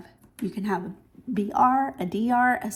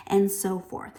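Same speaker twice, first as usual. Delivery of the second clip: ordinary delivery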